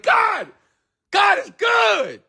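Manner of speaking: very high, slow ecstatic shout; inner scream. Retold or imitated. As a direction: cry out to heaven in joy. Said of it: "I'm screaming that".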